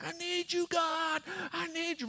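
very high, thin mimicked wail; imitated weeping cry. Man crying out in the street, imitated weeping. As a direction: call up to God in mock anguish. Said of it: "he's just weeping".

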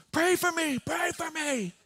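very high, wailed mimicked begging; imitated weeping plea. Big man hugging the pastor, mock-weeping plea. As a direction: plead desperately as the weeping man. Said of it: "he's just weeping".

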